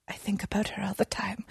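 soft unvoiced whisper, a secret on the line. Call center worker confiding to a caller in a whisper. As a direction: whisper it so the manager can't hear. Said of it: "and was whispering".

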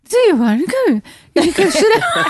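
hammed-up pizza man offering a seat. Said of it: "in my pizza man voice".

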